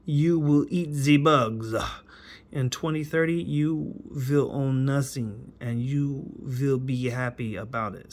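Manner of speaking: mock him with a heavy German accent; Klaus Schwab. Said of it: "mock him and use his German accent".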